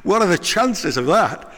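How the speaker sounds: high, loud, amazed question; hammed-up surprised shopper; mock astonishment. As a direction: act stunned by the coincidence. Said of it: "The guy's like".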